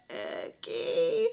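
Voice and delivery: breathy whispered okay. Retold or imitated. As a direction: breathe it out, barely voiced. Quiet reply after being scolded.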